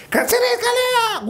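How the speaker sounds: very high, drawn-out screech; exaggerated high-pitched scolding shout; imitated parental yell. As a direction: mimic a mother yelling from another room. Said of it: "וואו, צעקה גדולה ומרה".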